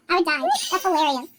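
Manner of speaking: usual speaking voice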